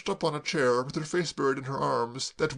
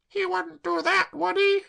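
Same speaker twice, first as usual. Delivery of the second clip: put-on much higher, slow wretched girl; tearful girl; 5 dB louder than usual. Claire sobbing to Braddock, high tearful wail.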